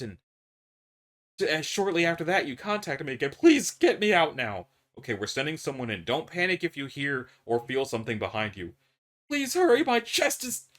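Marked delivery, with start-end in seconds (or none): 3.3-4.7 desperate plea. Acted. Trapped man begs again. 9.3-10.7 high, loud gasping plea trailing off; he's running out of air; terrified. Acted. Trapped man begs the doctor to hurry as his chest tightens, panicked.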